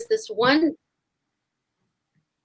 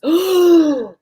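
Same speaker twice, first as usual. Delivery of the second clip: mock audience gasp; 5 semitones higher, 6 dB louder than usual. Audience gasping in shock.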